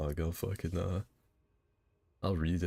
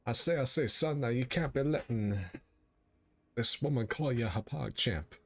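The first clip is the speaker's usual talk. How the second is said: southern blowhard; 3 semitones higher than usual. Acted. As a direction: puff up like an old rooster. Blustering southern rooster bit.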